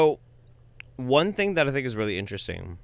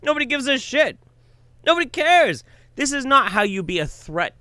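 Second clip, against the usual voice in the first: rising into a higher, louder exasperated yell; exasperated outburst; 13 semitones higher, 4 dB louder than usual. Real, not acted. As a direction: blow up in disbelief. Ranting at the man's antics.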